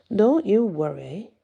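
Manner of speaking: lightly voiced arrogant boast; arrogant assurance. A narrator's reading. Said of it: "his voice oozing with arrogance".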